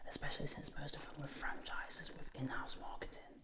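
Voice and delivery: fast, very soft whisper; whispered aside. Real, not acted. Speaker confiding a worry about too few dentists, whispered. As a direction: whisper it quickly, like a private thought.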